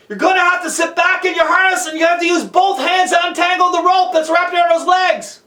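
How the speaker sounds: high, projected calling down; yelled instructions. Acted. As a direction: shout each step to someone far below.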